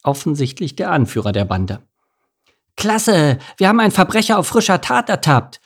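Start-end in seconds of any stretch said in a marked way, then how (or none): 2.8-5.6 put-on high, gleeful mouse kid voice; high-pitched childish triumph; delighted child. A narrator's reading. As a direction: crow over the catch like an excited kid. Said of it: "freute sich ein anderes Kind".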